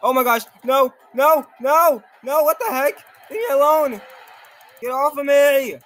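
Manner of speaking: high, loud frantic pleading; panicked screaming. Real, not acted. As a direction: scream it like you're being grabbed. Attacked by Tiki Men in game.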